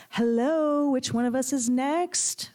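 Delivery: high, drawn-out call across the room. A narrator's reading. A girl in the booth heckles from afar, calling out. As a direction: sing it out from the booth, stretched. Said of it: "one of the girls in the booth called".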